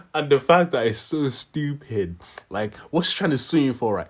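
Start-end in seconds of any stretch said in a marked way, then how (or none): none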